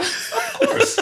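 high laughing aside. Real, not acted. Teasing about playing around.

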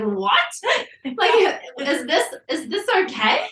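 high, laughing question; laughing disbelief. Retold or imitated. To her husband about the baby's head, laughing disbelief. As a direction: giggle through the disbelief.

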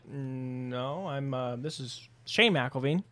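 low, halting, reluctant reply; awkward correction. Retold or imitated. Guest correcting the clerk about who he is, mock awkwardness. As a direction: imitate an awkward, drawn-out correction.

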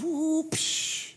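a high whoop then a splash noise; falling whoop. Retold or imitated. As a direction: yelp as you drop, then mimic the splash. Stepping off the high dive.

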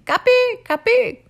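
very high, loud bird croak. Acted. Gilgamesh mimics the broken bird's cry, very high and loud. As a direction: squawk it like a broken-winged bird.

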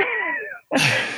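high, embarrassed burst of laughter; mortified laugh. Real, not acted. Retelling a phone call blunder, laughing, mortified. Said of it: "I'm laughing about that 'cause I'm mortified".

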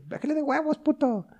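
high, mock scolding lady; lightly voiced crude señora; rude old lady. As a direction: snap at him like an old lady. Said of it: "la señora le dice".

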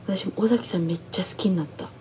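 unvoiced hushed agreement; soft whispered reply. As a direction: whisper it like a secret confession.

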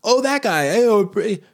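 high, loud gushing over an actor; mock excited. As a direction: squeal it like a starstruck fan. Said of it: "you're like".

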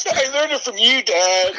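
high-pitched, melodramatic outburst; mock tearful accusation. Playing the son blaming his dad, mock accusation. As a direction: throw it at dad, hammed up and high.

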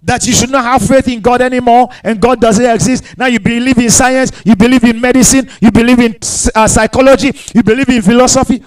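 ordinary talk

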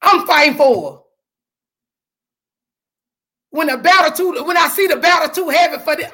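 rising church preacher's fervor; full-force preaching. Acted. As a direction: preach it out to the congregation.

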